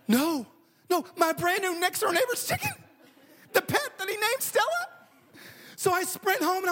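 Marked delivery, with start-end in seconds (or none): 0.1-4.9 high-pitched, dismayed protest; mock horrified disbelief. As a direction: replay your appalled reaction, pitch climbing. Acted dismay at daughter's news.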